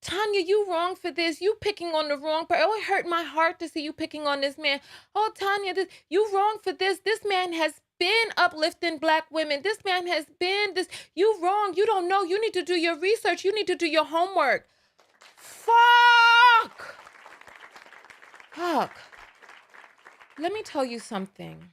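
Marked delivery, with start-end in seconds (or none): 0.0-14.7 high, loud whiny scolding voice; mock the preachy commenters. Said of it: "Who are like". 15.3-19.0 loud high exasperated yell; frustrated shout. Real, not acted. Frustrated outburst after mocking commenters.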